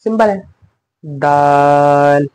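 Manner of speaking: दाल held out very slowly; stretched daal. Shown as a demonstration. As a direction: draw दाल out long and clear. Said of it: "जीम को भी थोड़ा खींच कर पढ़ा है".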